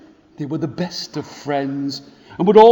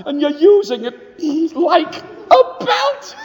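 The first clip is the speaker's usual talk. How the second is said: put-on very high, laughing little-boy voice; little boy laughing at the bully's belt; giggling little boy; 14 semitones higher than usual. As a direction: squeal it through giggles of delight. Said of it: "He started to giggle".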